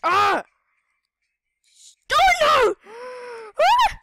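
high, loud short shrieks; scared squeals while running; frightened yelps. Real, not acted. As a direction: yelp each word in fright. Fleeing the TNT carrier.

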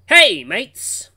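loud, high shouted greeting; calling out. Acted. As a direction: hail your friends from a distance. Seth calls out to the group.

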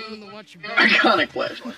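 drawn-out, voiceless mocking echo. Co-player echoing his partner's flashlight line, mocking. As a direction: echo it back slowly with hardly any voice.

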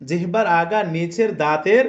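ordinary talk, nothing marked

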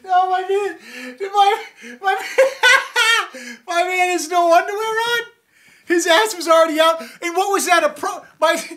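laughing hysterically. Real, not acted. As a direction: crack up in a high squeal. Laughing at fight in clip.

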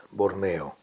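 low, solemn announcer imitation; mock radio-theatre narrator, pitched low. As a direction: set the scene like an old documentary announcer. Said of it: "un locutor que decía".

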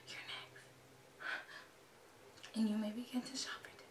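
barely audible whisper; whispered reading. Real, not acted. Fan reading that the turn is next, whispering. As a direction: whisper the words off the screen.

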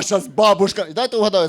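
ordinary talk

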